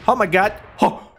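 usual speaking voice

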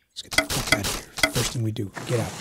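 hushed escape whisper. Real, not acted. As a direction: whisper the plan under your breath. Quiet plotting escape.

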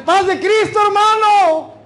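high, loud hollered church greeting; hearty old-time greeting. Acted. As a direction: holler it to someone far across the street. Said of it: "Los hermanos de más antaño decían".

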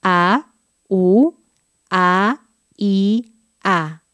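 each vowel "A, U, A, I, A" said separately and clearly; isolated vowels. Shown as a demonstration. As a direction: spell out the vowels one by one. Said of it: "Sería así".

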